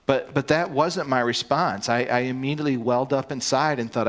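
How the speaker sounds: ordinary delivery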